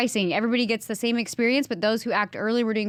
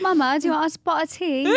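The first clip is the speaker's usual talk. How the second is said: higher-pitched mock-British voice; British accent; 4 semitones higher than usual. Shown as a demonstration. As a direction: ham up an amateur British accent for teatime. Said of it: "that was my British accent".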